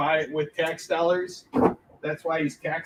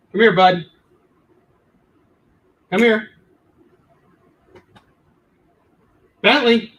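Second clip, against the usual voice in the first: real loud call, pitched up; calling the dog; 6 semitones higher, 11 dB louder than usual. Real, not acted. Owner calling his barking dog over, loud summons. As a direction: call out sharply to your dog.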